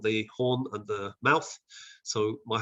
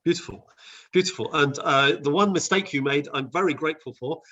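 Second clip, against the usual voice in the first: ordinary delivery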